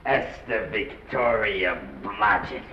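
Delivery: slow, low repeat of a name; slow incredulity. Acted. Press agent chews over the newcomer's name, slow and low.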